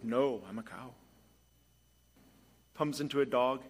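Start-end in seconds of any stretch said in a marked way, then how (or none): 0.0-0.9 a hint of a lower cow voice; lightly voiced cow. Cow answering the little bird, lightly voiced and lower. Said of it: "It's like".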